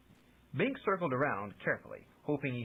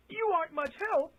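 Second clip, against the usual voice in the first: high, louder exasperated complaint; complaining; 5 dB louder than usual. A narrator's reading. Chameleon complaining to the useless magician, high exasperated. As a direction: whine at him in frustration. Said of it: "she complained".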